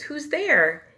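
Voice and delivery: high, loud startled mouse cry. Acted. Mouse spots eyes in the den, startled cry. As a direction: jump at the shadow and call out. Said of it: "Mouse cries".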